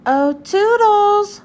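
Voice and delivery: Mickey calling Toodles from far away. Acted. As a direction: stretch it out calling for a friend.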